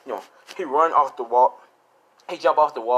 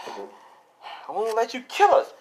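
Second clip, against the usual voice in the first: slow, high-pitched defiant cry; strained defiance; 10 semitones higher, much slower than usual. Acted. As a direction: drag it out high before charging. Acted defiance before charging parents.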